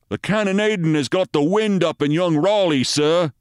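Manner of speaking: high, urgent sergeant's report; British sergeant. Acted. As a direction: report to your officer, high-pitched and urgent. Sergeant reports to his captain under fire.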